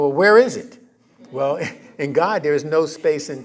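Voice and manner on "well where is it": mock impatient, louder and higher question; an eager asker wanting it now; half-playful loud "where is it"; impatient demand. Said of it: "Then you go".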